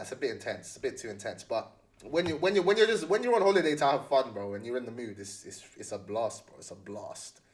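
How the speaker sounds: normal talking voice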